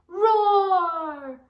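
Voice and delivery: loud roaring shout; lion roar. Shown as a demonstration. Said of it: "Roar like a lion".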